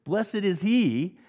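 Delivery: slow, loud, high "Blessed is he", each word weighted; drawn-out declaration "Blessed is he"; solemn emphasis. Shown as a demonstration. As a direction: proclaim "Blessed is he" with awe.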